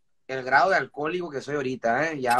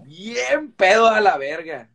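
slow, louder, stretched emphasis; drawn out; 6 dB louder, much slower than usual. Real, not acted. Bragging to friends about being wasted, slow and stretched. Recalling getting very drunk.

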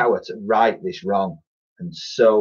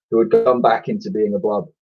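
usual speaking voice

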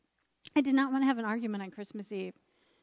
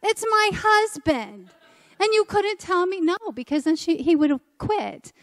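exasperated; 7 semitones higher, 5 dB louder than usual. Retold or imitated. Reminding the RCIA leader it was her own husband, in loud exasperation. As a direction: stretch out my husband in disbelief.

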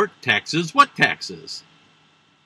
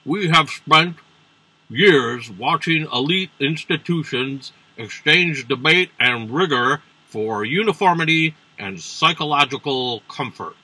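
Mitch McConnell. Acted. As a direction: draw out the words in a mock senator's voice. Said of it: "Mitch McConnell tweeted today that".

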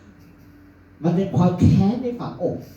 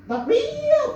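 much higher squeaky not real; high fake voice. Shown as a demonstration. Comedian demonstrates a liar's voice, much higher squeak. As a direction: squeak the words way up. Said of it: "the voice is high".

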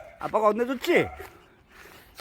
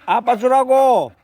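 slow, louder, drawn-out demand; slow loud insistence; 5 semitones higher, 7 dB louder, slower than usual. Real, not acted. Owner slowly and loudly insisting the dog give the ball. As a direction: spell it out firmly to the dog.

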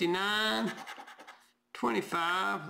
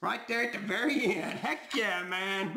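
ordinary talk

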